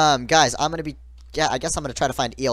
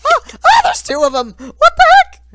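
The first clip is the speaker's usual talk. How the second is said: loud high panicked screaming; 12 dB louder than usual. Real, not acted. Chased by two players.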